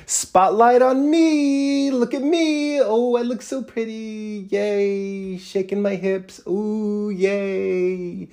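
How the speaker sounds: high, drawn-out preening falsetto; mock someone showing off in the spotlight; slow, airy, sing-song high voice of self-admiration; mock attention seeker. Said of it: "trying to be like".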